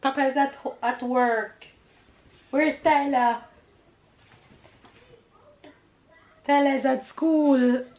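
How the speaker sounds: high, sing-song mommy voice. Real, not acted. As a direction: pitch it up for a little one. Mother answering her toddler.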